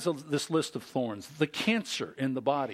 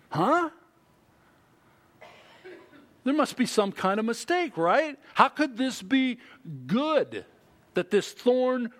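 mock disbelief; 5 semitones higher, 4 dB louder than usual. Mock objection from the congregation.

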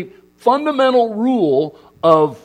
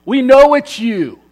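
mock whiny kids, higher, louder and slowing at the end; exasperated kids; 8 semitones higher, 6 dB louder, much slower than usual. Kids correcting dad about his texts, mock exasperated. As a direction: imitate the kids rolling their eyes. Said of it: "And my kids go".

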